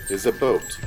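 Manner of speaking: usual speaking voice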